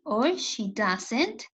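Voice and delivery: she doesn't, stretched out slowly; slow model. Shown as a demonstration. Teacher dictates the contraction to her students. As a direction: lay out the short form slowly. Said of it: "teacher slowly models short form".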